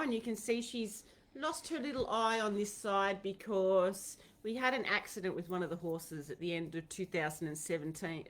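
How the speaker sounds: ordinary talk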